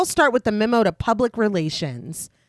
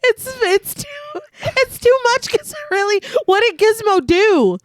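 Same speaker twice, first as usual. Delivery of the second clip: much higher, pleading indignation; indignant; 12 semitones higher than usual. Real, not acted. Outraged defence of Gizmo.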